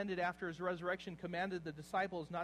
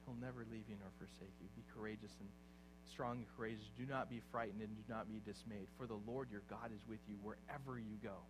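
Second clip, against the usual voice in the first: softer and lower, a hushed promise; soft reassurance; 6 semitones lower, 5 dB quieter than usual. Acted. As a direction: comfort them gently, almost privately. Quiet tender scripture reassurance.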